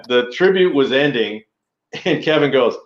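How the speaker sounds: normal talking voice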